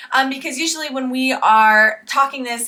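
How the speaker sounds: ordinary talk, nothing marked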